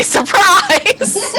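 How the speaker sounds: high, laughing shout of surprise; spring it on them cheerfully; playful surprise. Pretend. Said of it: "open the door and say".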